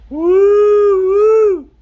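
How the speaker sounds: loud, high two-part hoot; hollered cave call. Acted. Cowboy calling into a big cave, high hollered hoot. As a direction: holler a long "woo-woo" into a cave. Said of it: "he hollers into this big old cave".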